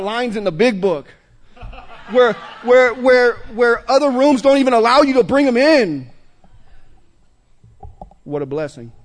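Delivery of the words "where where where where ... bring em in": louder, higher passionate shout; a crowd-rousing shout about recovery; excited shout. Real, not acted. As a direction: preach it with fire.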